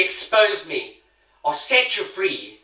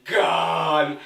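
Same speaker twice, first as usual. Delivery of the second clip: "Gone" barked out as a coarse cockney cry; mock cockney shout; 2 semitones lower than usual. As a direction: shout "Gone" in a mocking street accent. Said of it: "what sort of word is that".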